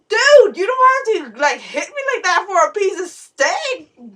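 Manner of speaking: high, loud protest with a laugh at the end; upset protest. Retold or imitated. Daughter protests to dad after being smacked, high loud outcry. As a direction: cry out in disbelief, then laugh it off.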